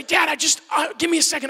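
a hint of a whiny child stalling; son answering back. Son in the shower pleading with dad, protesting. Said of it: "he'll yell back at me".

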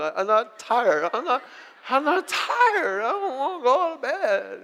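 mock drunk toddler insisting he's not tired; drunk little kid. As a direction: play the overtired boy like a tipsy little drunk. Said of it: "they're like little drunk people".

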